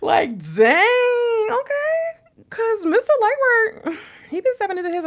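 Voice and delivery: very high, loud, squealing gush; excited squeal. Real, not acted. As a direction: squeal like you can't contain it. Gushing over her partner.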